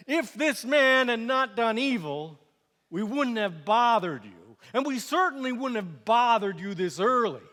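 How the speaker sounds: mild outrage from pushy accusers; indignant accusation. Acted. Priests shoving a prisoner toward the governor, indignant.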